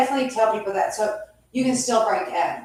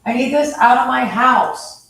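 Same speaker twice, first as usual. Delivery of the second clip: forceful, raised voice; loud demand; 3 semitones higher, 10 dB louder than usual. Real, not acted. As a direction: demand it loudly. Ordering intruders out of house.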